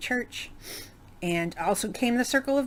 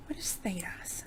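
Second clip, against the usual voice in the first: a private unvoiced aside, half to herself; whispered aside; 11 dB quieter than usual. Real, not acted. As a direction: whisper it to yourself, puzzled. Aside questioning herself mid-explanation.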